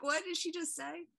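high, baffled question; shocked. Retold or imitated. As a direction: act stunned and confused. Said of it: "'cause you were like".

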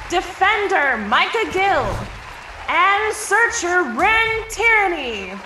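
loud, drawn-out player introductions; stadium announcer. Acted. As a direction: stretch each name like a sportscaster. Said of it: "as Everest continues".

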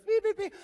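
high, loud electronic beeps; imitated detector beeps. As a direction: sound like a detector going off. Detector going off over trips.

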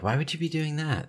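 imitating his wife's puzzled disbelief; dumbfounded. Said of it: "She was looking at me dumbfounded".

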